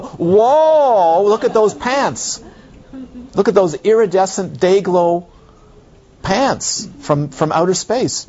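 high, hammed-up whoa at the pants; mock awe. Teacher pretends visitors gape at his pants, mock awe. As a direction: gush over the Lama's pants in jest. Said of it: "look at the Lama and go".

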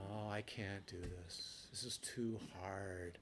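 soft, slow mock moan of giving up; mock despair. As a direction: quietly whine that it's too hard. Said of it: "now you're [name] going".

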